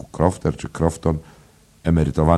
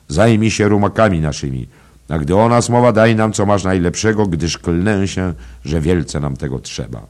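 loud, lordly, raised-pitch command; called-out order; 5 semitones higher, 6 dB louder than usual. A narrator's reading. Traveller demanding care for the horses, called-out order. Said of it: "zawołał ów, który pukał uprzednio".

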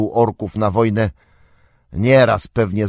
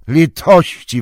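louder, exasperated cry; exclaiming Gandalf; 7 semitones higher, 7 dB louder than usual. A narrator's reading. Gandalf to nosy Pippin, exasperated exclamation. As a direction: throw up your hands, mercy. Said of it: "wykrzyknął Gandalf".

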